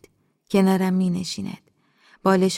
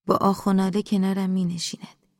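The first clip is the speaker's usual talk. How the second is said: usual speaking voice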